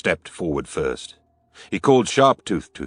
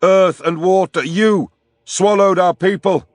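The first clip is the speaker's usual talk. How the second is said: furious high bellow at the spirits; 12 semitones higher, 7 dB louder than usual. A narrator's reading. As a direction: hurl it at the sky in grief and rage.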